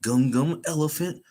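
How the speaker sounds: Luffy's attack call. Retold or imitated. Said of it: "He's like".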